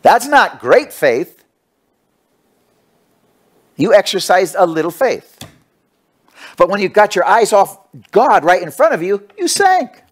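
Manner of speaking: preacher rebuking weak faith, fired up; preaching at full force. Acted. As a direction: hammer the point home to the crowd. Exhorts congregation at full force.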